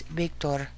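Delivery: "Victor" opened with a soft B. Shown as a demonstration. Teacher showing listeners Spanish V, "Victor" with soft B. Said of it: "soft B".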